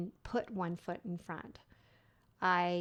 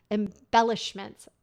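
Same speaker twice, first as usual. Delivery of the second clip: ordinary delivery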